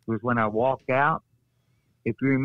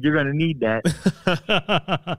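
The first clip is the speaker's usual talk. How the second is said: laughing slow quote; 4 semitones higher, 4 dB louder, much slower than usual. Retold or imitated. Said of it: "He said".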